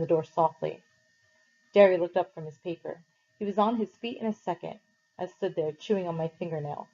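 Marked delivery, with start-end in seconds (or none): none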